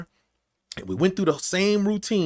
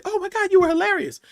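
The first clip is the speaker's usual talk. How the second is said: gushing fan. Retold or imitated. Imagined fans gushing.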